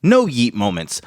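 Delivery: slow, dramatic show opener; announcer intro. Acted. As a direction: announce it like a wrestling promo.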